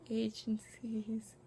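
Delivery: slow, whispered murmur to oneself; thinking aloud. Real, not acted. Vlogger recalling who attends the showcase, whispering to self. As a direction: whisper it slowly while searching your memory. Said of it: "trying to remember".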